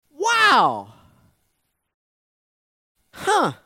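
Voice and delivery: ordinary talk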